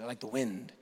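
deep, hushed mystical intonation; ethereal sage. Retold or imitated. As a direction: breathe it out like wind.

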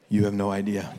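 very low, jaded reply; acted grim knowingness; world-weary deadpan. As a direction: drop far down like a disillusioned veteran. Married people's jaded answer to singles.

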